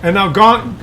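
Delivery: usual speaking voice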